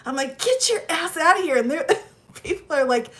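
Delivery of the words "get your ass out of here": high, quick mock-angry dismissal; mad. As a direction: order them out, fast and high.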